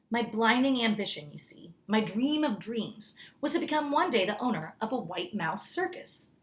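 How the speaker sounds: ordinary delivery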